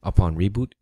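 normal talking voice

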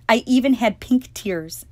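ordinary delivery, nothing marked

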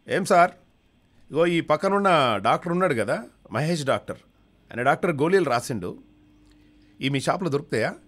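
high, loud pleading customer voice; eager patient. Acted. Patient asking the pharmacist for pills, high and loud. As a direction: push up high and call out to the shopkeeper. Patient asks at medical shop.

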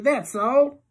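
high, loud slurred reply. A narrator's reading. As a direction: drunk and slurring. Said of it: "her voice is slurpy".

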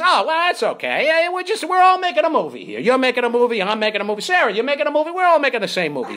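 high, singsong breezy reassurance; retold easygoing star, exaggerated lilt; reassuring movie star. Acting Hanks reassuring everyone.

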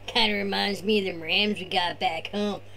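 laid-back surfer bro; be the chill beach surfer dude; Tony surfer voice. Acted. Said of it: "turn this over to Tony".

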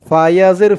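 normal talking voice

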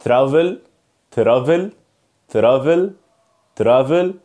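"ترافل" with a plain t and r, no "ch" sound; "ترافل" with the t kept separate from the r; wrong TR pronunciation. Shown as a demonstration. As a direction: say "ترافل" as t plus r, like a learner's mistake. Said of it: "يلا نبدأ مع اول حاجة وهي النطق الغلط".

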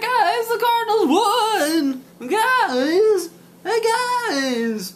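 very high, drawn-out excited voice; Bozzy. Acted. Speaking for excited Bozzy about the Cardinals win, high squealed voice. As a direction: squeal it slowly, thrilled to tell everyone. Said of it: "is that how he talks?".

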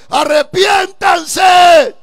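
a prophet crying out the warning, slow and loud; preaching cry. Retold or imitated. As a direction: call out the warning slowly. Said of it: "Noé pregonaba la palabra del Señor diciendo".

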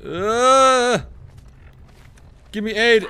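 building from loud pleas to a very high scream; desperate yelling. Real, not acted. Begging for healing while dying.

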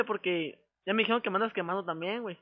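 ordinary talk, nothing marked

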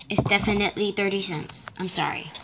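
ordinary delivery

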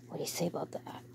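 soft unvoiced whisper. Real, not acted. Owner whispering a haircut plan to her dog. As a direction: breathe it close, no voice.

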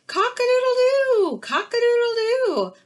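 rooster crow. Acted. Teacher crowing as the rooster for the kindergarten class, high and loud. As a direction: belt it out like a proud rooster at dawn.

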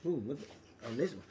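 ordinary delivery

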